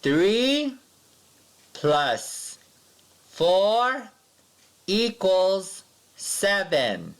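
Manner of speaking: high, slow, stretched equals seven; read-along equation. Shown as a demonstration. Teacher reads the equation for pupils to echo, slow and high. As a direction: model each word for the class to repeat. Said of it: "Repeat after me".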